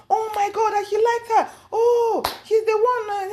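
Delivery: very high-pitched delighted exclaiming; hammed-up thrilled outburst; imitated excited shouting. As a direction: squeal it like an overjoyed friend. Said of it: "She started shouting".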